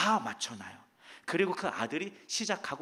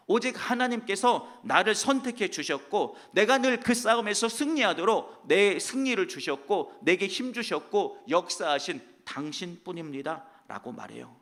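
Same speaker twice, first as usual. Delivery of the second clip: usual speaking voice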